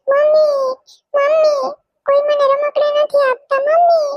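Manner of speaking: put-on a kid crying that no one shares toys; wailing child. Little girl wails to her mother that nobody gives her toys.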